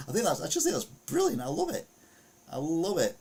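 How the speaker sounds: high-pitched, gleeful gushing; delighted. Real, not acted. Host raving about the naked pranksters' stunt, high delighted gushing. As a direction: gush with real amusement, voice shooting up.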